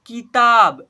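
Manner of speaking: "किताब" loud, both syllables stretched; model reading. Shown as a demonstration. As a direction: sound out "किताब" emphatically for learners. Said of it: "ऐसे पढ़ेंगे". ("किताब" को ज़ोर से, साफ़ पढ़ें)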